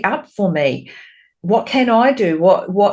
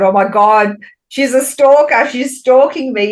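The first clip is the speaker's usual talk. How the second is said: mock shocked client; 6 semitones higher, 5 dB louder than usual.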